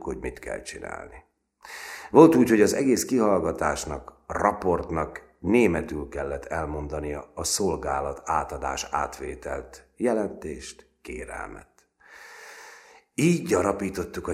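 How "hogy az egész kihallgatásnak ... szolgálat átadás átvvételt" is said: ordinary delivery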